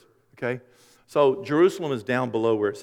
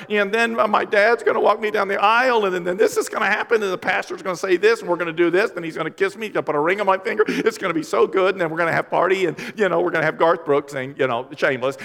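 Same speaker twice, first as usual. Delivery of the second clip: lightly voiced starry-eyed bride, a touch higher; dreamy bride; 5 semitones higher, 4 dB louder than usual. Imitating a bride telling the pastor her dream wedding. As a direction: daydream the big day like a little girl. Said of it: "You're like".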